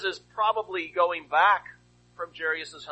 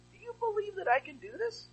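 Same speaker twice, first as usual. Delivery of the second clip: voiced question; 13 semitones higher, 5 dB quieter than usual. Preacher voicing Jesus' question.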